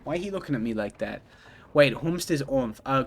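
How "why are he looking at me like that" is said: low, soft, quick aside; quiet, hurried question under the breath; subdued repeat. Real, not acted. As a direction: mumble it quickly to yourself. Repeats the same question to themselves.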